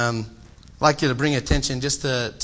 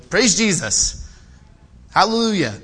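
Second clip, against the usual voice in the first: raised, louder praise shout; praising aloud; 6 semitones higher, 5 dB louder than usual. Pretend. Preacher voicing a crowd at a healing, raised praise.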